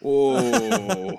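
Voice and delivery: loud, high mock "whoa"; hammed-up double take; exaggerated astonishment with a laugh in it; retold amazement. Retold double take on seeing image.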